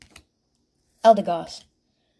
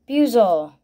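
normal talking voice

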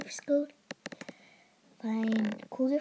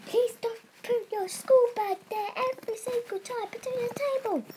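high scolding sister; 9 semitones higher than usual. Acted.

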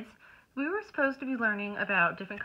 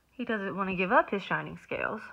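ordinary delivery

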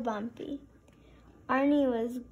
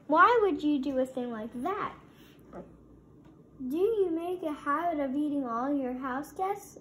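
outraged donut; 4 semitones higher, 4 dB louder than usual. A narrator's reading. Donut confronts its eater.

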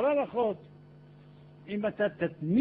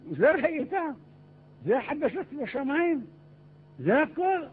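high-pitched, loud doubting questions; incredulous questioning; 9 semitones higher, 4 dB louder than usual. Acted. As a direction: question it as if expecting far more. People question Moses' report in disbelief.

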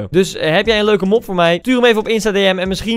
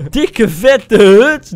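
celebrating; 3 semitones higher, 8 dB louder, much slower than usual. Real, not acted. Drawn-out celebration.

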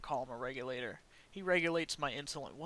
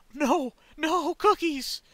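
shouting; 11 semitones higher, 12 dB louder, much slower than usual. Real, not acted. Teammate dying.

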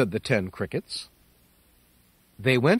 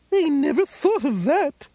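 put-on old lady voice; 13 semitones higher than usual. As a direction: invite him in as a tearful old lady.

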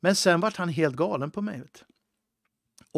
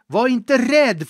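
loud, high, slow, hammered out; forceful command; 9 semitones higher, 12 dB louder, much slower than usual. Acted. Preacher loudly commanding the congregation not to fear. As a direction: bellow it as a command against fear. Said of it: "varit för hård här nu".

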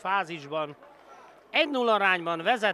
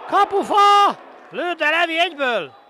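excited shout; 12 semitones higher, 11 dB louder than usual. Real, not acted. Goal post near miss call.

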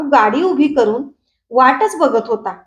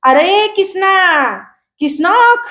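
high, long-drawn call; drawn-out village holler, pitched high; hailing a friend; 6 semitones higher, slower than usual. A narrator's reading. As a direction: call out to a friend across the fields, stretching the name. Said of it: "तिथनंच हळी दिली".

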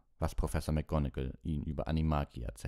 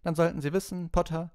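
much higher, sharper teacher voice; a higher-pitched stern schoolmistress; stern professor; 13 semitones higher, 6 dB louder than usual. A narrator's reading. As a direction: scold the class as their professor. Professor addresses Harry.

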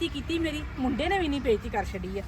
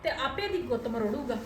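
softer, lower muttered plotting; scheming aside; 4 semitones lower, 6 dB quieter than usual. Acted. Mother-in-law alone after the quarrel, quietly plotting. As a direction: mutter your scheme to yourself.

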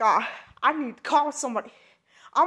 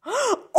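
startled gasp; 8 semitones higher, 3 dB quieter than usual. Acted. Wakes up as a frog.

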